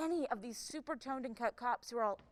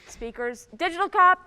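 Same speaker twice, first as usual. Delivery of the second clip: loud, higher summoning call; 6 semitones higher, 13 dB louder than usual. Acted. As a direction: shout the name out. Chief summoning cops by name.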